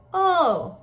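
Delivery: high, loud, round "oh"; wide-open "oh" demo. Shown as a demonstration. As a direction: drop the jaw on a loud "oh". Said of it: "My tongue is flat".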